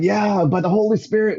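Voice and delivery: high, beaming answer; joyful reply. Retold or imitated. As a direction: proudly claim the Spirit, pitched high. Cheerfully answering friend's accusation.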